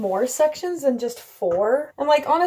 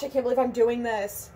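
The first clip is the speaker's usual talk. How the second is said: ordinary talk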